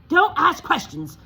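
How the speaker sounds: high, loud snap; snapped. Acted. Uncle Vernon to Harry, a loud snap. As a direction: bite the words off. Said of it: "snapped his uncle".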